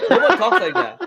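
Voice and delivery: loud, high, laughing. Real, not acted. Friend mocking Toronto slang, loud and laughing. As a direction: laugh it out, insisting. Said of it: "laughing insistence".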